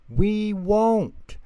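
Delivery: put-on high eager toddler chorus; promising baby voice. A narrator's reading. Babies promising Angelica, high eager toddler voice. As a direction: promise sweetly.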